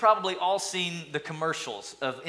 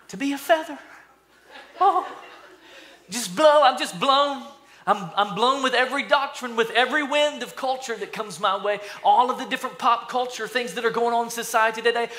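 high, loud mock-airy voice; blown-about feather; 7 semitones higher, 4 dB louder than usual. Someone who thinks meekness is being a feather, high and mock carried away. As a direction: play a feather carried off, pitched way up. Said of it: "they think to be meek is".

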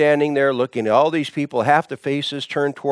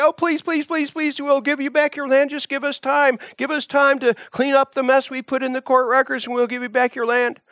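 high pleading whine, pitched way up; mock begging; 12 semitones higher, faster than usual. A narrator's reading. Officials beg the prisoner for time, high whining plea. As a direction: squeal it out like a desperate official.

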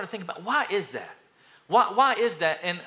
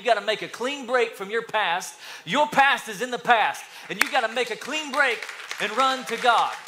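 raised, louder exhortation; forceful preaching; 5 semitones higher, 4 dB louder than usual. Real, not acted. Preacher urging church to leave the past, forceful preaching. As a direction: drive it home to the congregation.

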